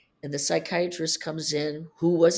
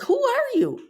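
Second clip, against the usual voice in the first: much higher, incredulous cry; retold disbelief, pitched way up; shocked outburst; 5 dB louder than usual. Wife stunned by husband in front of psychiatrist, high shocked cry.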